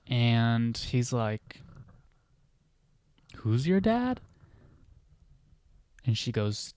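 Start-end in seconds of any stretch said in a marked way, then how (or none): 3.4-4.2 high, puzzled question; incredulous repeat. Retold or imitated. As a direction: ask as if you can't believe it. Stage guy repeats question, puzzled.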